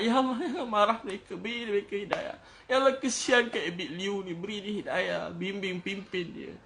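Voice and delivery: high, pleading prayer that softens and slows; meniru doa menangis. Retold or imitated. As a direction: act out a tearful plea, fading toward the end. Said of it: "Malam-malam nangis". (meniru rayuan sayu bernada tinggi, makin perlahan)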